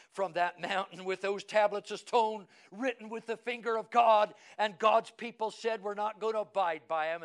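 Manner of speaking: loud, high-pitched pulpit proclamation; full-force preaching. Acted.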